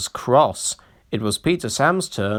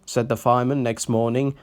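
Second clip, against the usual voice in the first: ordinary delivery, nothing marked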